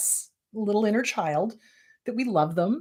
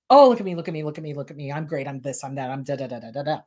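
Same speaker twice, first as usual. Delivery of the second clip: mock narcissist; 6 semitones lower, faster than usual. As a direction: mimic a show-off begging for attention. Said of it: "narcissists need to walk into a room and announce".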